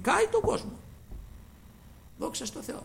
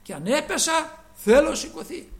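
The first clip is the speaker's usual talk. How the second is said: defiant cry; 8 semitones higher, 8 dB louder than usual. Acted. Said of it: "Του μιλάμε και μας απαντά".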